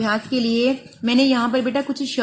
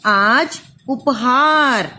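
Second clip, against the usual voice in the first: slow sounding out; much slower than usual. Shown as a demonstration. Teacher dictating आज उपहार slowly to pupils. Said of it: "तो पहले आप लिखेंगे आ".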